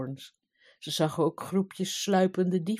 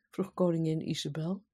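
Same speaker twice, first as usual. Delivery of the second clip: usual speaking voice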